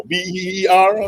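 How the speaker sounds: slow letters B E E R, each one separate; spelling it out. Shown as a demonstration. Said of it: "how you spell that beer?".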